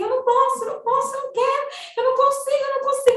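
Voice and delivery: very high, frantic imitation of yelling; retold screaming. Son at breaking point screams at mother, retold in high frantic cries. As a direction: repeat it desperately at the top of your pitch. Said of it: "aí ele começou a gritar".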